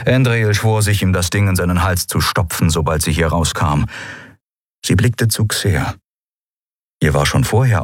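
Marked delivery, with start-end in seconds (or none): none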